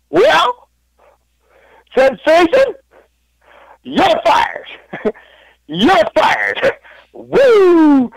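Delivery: high, loud, hammed-up Ric Flair yell; Ric Flair impression. Telling his housemates he's fired, in a loud high Ric Flair voice. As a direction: belt it out like Ric Flair with a big woo. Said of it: "And I was like".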